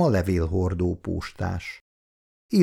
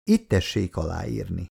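usual speaking voice

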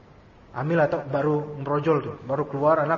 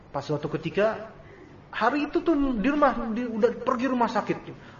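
usual speaking voice

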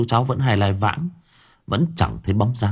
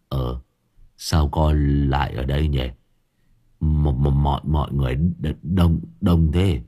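low, slow, stammering confusion; dazed; 6 semitones lower than usual. A narrator's reading. Boy wakes among villagers, dazed stammer. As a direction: still half asleep, lost. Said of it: "ngơ ngác".

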